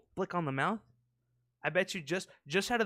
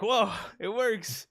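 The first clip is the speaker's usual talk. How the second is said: excited whoa; 7 semitones higher, 5 dB louder, much slower than usual. Real, not acted. Excited reaction.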